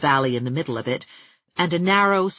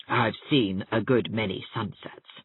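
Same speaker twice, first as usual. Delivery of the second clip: cold; 5 semitones lower, 3 dB quieter than usual. A narrator's reading. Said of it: "said the latter coldly".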